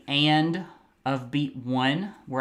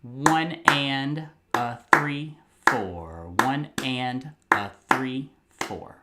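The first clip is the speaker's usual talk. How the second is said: one and a three, four counted in rhythm; teacher counts the rhythm; rhythmic counting; slower than usual. Shown as a demonstration. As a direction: count the syncopation aloud. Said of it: "then we'll count it".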